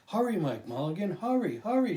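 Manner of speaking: put-on a boy's excited shout. A narrator's reading. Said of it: "shouted the little boy".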